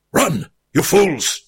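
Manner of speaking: slow, high mock-epic cry; a dramatic wizard's last order; wizard's command. A narrator's reading. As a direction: Gandalf warns Frodo and Sam. Gandalf swept away.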